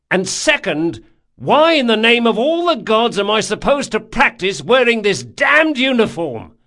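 high, loud, indignant threat; angry tirade. A narrator's reading. Captain with mimed blade threatening sailor, angry tirade. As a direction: rant theatrically with exasperated force.

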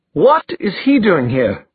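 high, aghast question; shocked outrage. A narrator's reading. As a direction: demand it in startled disbelief. Brother walks in on couple.

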